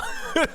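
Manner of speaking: high-pitched burst of laughter; laughing. Real, not acted. Caster caught generalizing about Europeans, laughing. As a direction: crack up at yourself.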